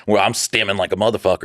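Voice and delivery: ordinary talk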